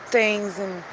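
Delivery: normal talking voice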